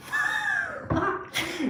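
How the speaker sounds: high burst of laughter; shrieking laugh. Real, not acted. Vlogger laughing at her own gum story, a high shrieking laugh. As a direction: crack up at the memory.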